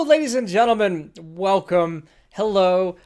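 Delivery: ordinary delivery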